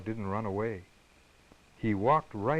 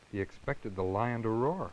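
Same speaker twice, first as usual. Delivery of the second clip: ordinary delivery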